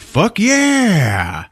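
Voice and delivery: high, mock-triumphant cheer; acted triumph. Storyteller cheering Cindy's flamethrower kill, triumphant yell. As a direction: crow it like an action-film victory.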